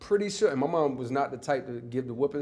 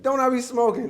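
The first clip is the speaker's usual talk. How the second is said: proud of it; 10 semitones higher, 6 dB louder than usual. Retold or imitated. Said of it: "Like, proud of it".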